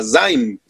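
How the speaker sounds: name "zaim" like an Arabic letter; Arabic letter zain demonstrated; zaim sound. Shown as a demonstration. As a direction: say "zaim" with a voiced z, falling. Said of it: "en los sonidos S".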